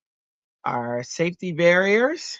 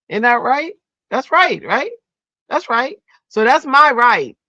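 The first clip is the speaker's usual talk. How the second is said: playful high; 10 semitones higher, 8 dB louder than usual. Acted. Playful high-pitched chatter.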